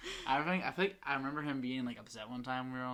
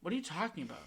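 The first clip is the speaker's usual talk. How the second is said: mock bossy cousin; 6 semitones higher than usual. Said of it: "She's like".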